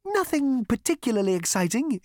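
high-pitched machine admission; admitting elevator. A narrator's reading. Elevator admitting dull options to Zaphod, high voice. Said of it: "it admitted".

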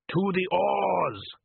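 high, very slow Klatchian call; rally the rowers; Klatchian shout. Acted. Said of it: "shouted someone in the other boat".

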